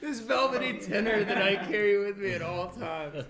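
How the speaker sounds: loud, high, laughing self-praise; mock grandiose. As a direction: ham up a pompous boast. Mock boast about voice.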